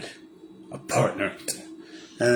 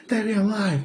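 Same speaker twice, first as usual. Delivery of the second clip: put-on pitched way up, young and hurt; squeaky mock Robin lashing out with a laugh; high Robin voice; 11 semitones higher than usual. Mocking Robin yelling at Bruce, high put-on voice. Robin line repeated after aside.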